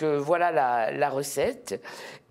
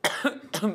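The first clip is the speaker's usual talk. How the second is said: sudden real coughing fit; choking cough; 4 semitones higher than usual. Real, not acted. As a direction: cough as if something went down wrong. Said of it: "Pardon, je m'étouffe".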